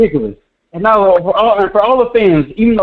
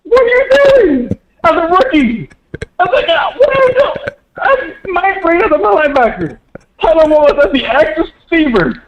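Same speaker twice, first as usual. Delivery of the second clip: real exasperated high-pitched yelling, laughing in disbelief; crashing out; 6 semitones higher than usual. Real, not acted. Caller ranting to host about the coaching, exasperated yelling. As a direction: rant at the top of your voice, half laughing, half crying. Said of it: "never seen Cam crash out like that".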